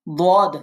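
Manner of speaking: দদ said full and heavy, por; heavy dad. Shown as a demonstration. Teacher drilling the heavy dad letter. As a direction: give দদ a thick, emphatic sound. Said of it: "পোর পড়তে হবে".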